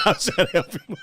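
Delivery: squeaky, high laughing through the words. Real, not acted. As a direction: laugh while faking innocence. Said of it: "laughs on the line".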